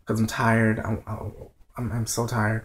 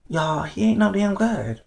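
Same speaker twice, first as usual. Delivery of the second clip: heated outburst; 7 semitones higher, 4 dB louder than usual. Real, not acted. Heated rant about character.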